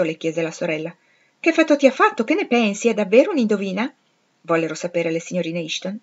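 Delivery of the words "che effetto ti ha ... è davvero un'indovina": high, loud, excited curiosity; breathless-to-hear sisters' chorus of questions; eager questioning. A narrator's reading. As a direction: fire off questions, dying to know.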